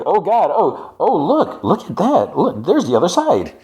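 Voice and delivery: high-pitched, acted-out astonishment; amazed discovery. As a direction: imitate someone gasping at an incredible sight. Acting Josh Gad's amazed discovery.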